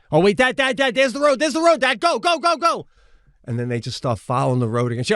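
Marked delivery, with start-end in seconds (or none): 0.1-2.9 much higher, urgent shouts to dad; wife urging her father on in the whiteout, urgent; urgent excitement. Retold or imitated. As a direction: spot the road and hurry him on. Wife spots road in whiteout.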